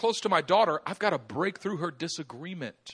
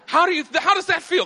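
louder, pressing the question hard; rising emphatic challenge; 11 semitones higher, 9 dB louder than usual. Real, not acted. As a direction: drive it home to the crowd. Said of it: "preacher presses the point".

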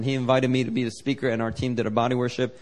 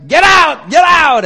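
much higher and louder, barked twice; aggressive shout; 14 dB louder, slower than usual. Retold or imitated. Pastor gripping the girl's head, shouting at the demon. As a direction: drive the demon out with force. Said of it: "more the aggressive Pentecostal style".

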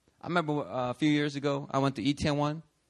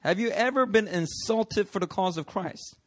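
ordinary talk, nothing marked

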